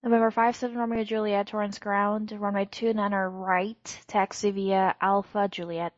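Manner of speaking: ordinary talk, nothing marked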